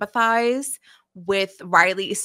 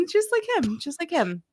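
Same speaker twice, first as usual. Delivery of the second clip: laughing; 9 semitones higher than usual. Real, not acted. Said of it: "so quite".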